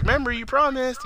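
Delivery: put-on very high, childlike parting plea; imitated small creature begging; pleading little creature. Little creature pleads while leaving.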